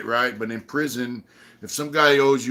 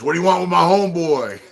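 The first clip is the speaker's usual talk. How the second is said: raised, louder tough-guy challenge; mock challenge; 6 semitones higher, 6 dB louder than usual. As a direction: square up with a retold threat. Homeboy puts fists up, challenging.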